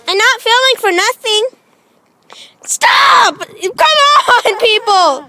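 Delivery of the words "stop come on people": shouting. Real, not acted. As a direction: yell at them to stop. Kids popping her bubbles.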